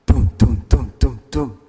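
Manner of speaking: low, loud thudding footsteps; booming running steps, low and loud; heavy footsteps. Retold or imitated. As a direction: stomp it out like a running father. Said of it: "I heard a louder sound".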